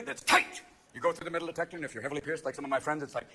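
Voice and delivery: ordinary delivery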